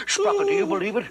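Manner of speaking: high, fast disbelief; excited. Acted. Owner asking his dog Sprocket in high excited disbelief.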